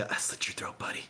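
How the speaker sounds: hushed mock-assassin whisper; stealthy whisper. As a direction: whisper it like a secret kill. Acts a stealthy mock kill.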